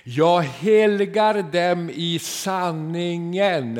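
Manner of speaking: slow, loud, weighty reading; solemn scripture quote. Acted. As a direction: let each word of scripture land slowly. Quoting Jesus in sermon.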